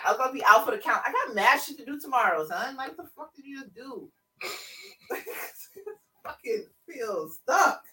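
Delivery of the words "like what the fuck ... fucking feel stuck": real laugh-cry while speaking; gasping through laughter. Real, not acted. Host recovering from being broken with laughter, real laughing. Reacting after being tickled.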